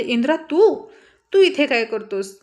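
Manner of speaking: sudden loud, high cry of shock; startled outburst. Acted. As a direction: catch him there and gasp it out. Shocked girl finds him.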